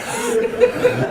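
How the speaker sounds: high burst of laughter; a real, high giggle. Real, not acted. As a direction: laugh at your own slip. Laughs at own breathing mistake.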